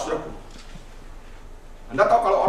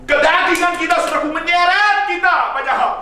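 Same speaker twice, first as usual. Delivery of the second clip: preaching shout; 8 semitones higher, 4 dB louder than usual. Acted. Preacher raises voice.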